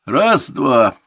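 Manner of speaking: high, drawn-out parade order; loud sergeant's shout; shouted drill command. A narrator's reading. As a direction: bellow it to a whole squad. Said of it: "Контуженный крикнул".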